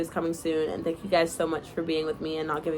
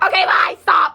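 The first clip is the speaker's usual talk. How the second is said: high loud yelp of bye and stop; embarrassed shout; 12 semitones higher, 11 dB louder than usual. Real, not acted. Embarrassed reader yells at herself to stop, high and loud. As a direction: shout it, flustered and laughing.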